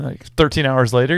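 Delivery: ordinary talk, nothing marked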